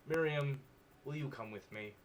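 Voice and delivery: normal talking voice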